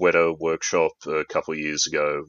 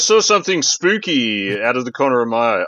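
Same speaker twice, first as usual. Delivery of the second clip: hammed-up horror narrator, pitched up; mock-spooky; 6 semitones higher than usual. Co-host spoofing a chapter cliffhanger, mock-spooky. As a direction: overplay the eerie suspense.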